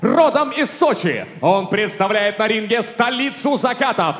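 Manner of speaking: rising to a long high shout; building announcer yell. Acted. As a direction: build to a roaring name call. Announcer builds to name call.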